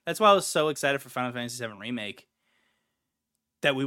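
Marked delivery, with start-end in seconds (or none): none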